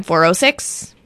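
ordinary talk, nothing marked

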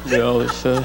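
put-on a slow, hesitant old-film drawl; drawling Jimmy Stewart. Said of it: "Jimmy Stewart in the car".

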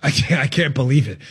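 low, deadpan fake shock; sarcastic disbelief. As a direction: groan it in mock disbelief. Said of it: "if you're sensing the dripping sarcasm".

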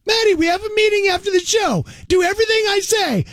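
put-on high, bossy little girl voice; little Maddie voice. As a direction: boss everyone around like a kid. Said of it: "I'll play the second half".

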